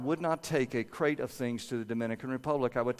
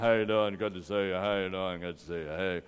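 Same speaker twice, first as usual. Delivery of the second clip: big dad's greeting; 6 semitones lower than usual. Retold or imitated. Dad greeting people in the mall, lightly voiced hearty big man. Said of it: "this great big guy talking like".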